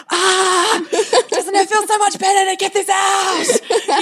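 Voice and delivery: very high, drawn-out playful wail; shaken-out holler. Acted. Mother shake-rubbing her son to release frustration, playful high holler. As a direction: holler it high as if shaking someone loose. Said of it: "shake rubbing him going".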